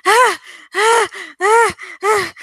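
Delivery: high, loud bursts of screaming; terrified screams. Acted. Woman sees lobster kids in her kitchen, terrified screams. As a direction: shriek in terror. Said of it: "she was screaming".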